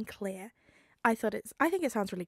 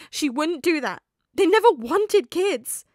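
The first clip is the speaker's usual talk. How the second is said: near tears, loud; 8 semitones higher, 14 dB louder than usual. Acted. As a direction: plead through rising tears. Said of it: "Peter's nose stung with tears".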